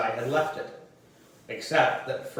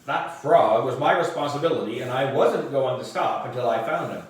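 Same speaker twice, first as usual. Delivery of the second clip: plain reading voice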